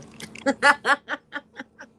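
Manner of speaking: high, loud burst of laughter; real laugh. Real, not acted. Streamer after reading a viewer's comment aloud, loud high laugh.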